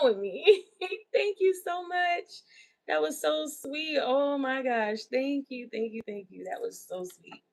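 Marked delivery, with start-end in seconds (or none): none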